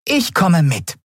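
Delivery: high, quick declaration; determined volunteer. A narrator's reading. (entschlossen, mit hoher Stimme)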